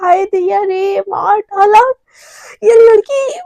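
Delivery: high, stretched-out mock wail; pained wailing. Mishka moaning after being shoved down, pained high wail. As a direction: wail it like a hammed-up victim.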